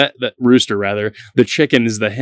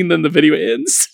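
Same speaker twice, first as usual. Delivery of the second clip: usual speaking voice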